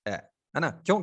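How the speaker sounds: ordinary delivery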